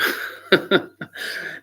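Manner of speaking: a long high wheezing laugh; real high laugh. Real, not acted. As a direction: crack up at the memory. Laughs at his own story.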